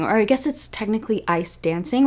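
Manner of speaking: usual speaking voice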